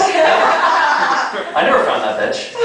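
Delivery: laughing. Real, not acted. Punchline through laughter.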